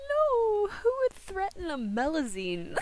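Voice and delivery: high, loud shocked outcry; shocked gasp. Real, not acted. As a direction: react in disbelief. Shocked at threat news.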